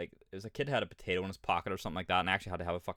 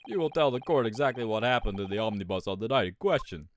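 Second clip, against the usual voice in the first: louder, commanding courtroom voice; game judge; 6 dB louder than usual. Acted. Judge ordering testimony about the omnibus, commanding. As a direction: order the witness from the bench.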